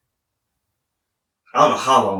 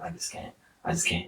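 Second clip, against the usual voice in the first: breathy hush, fighting to stay conscious; barely voiced strained whisper; 4 semitones lower, 13 dB quieter than usual. To himself in the CT scanner, strained whisper. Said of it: "And I was like".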